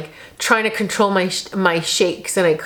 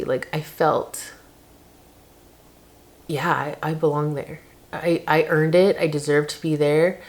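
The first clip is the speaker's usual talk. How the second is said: emotional; 4 semitones lower, 3 dB quieter than usual. Real, not acted. Emotional about deserving it.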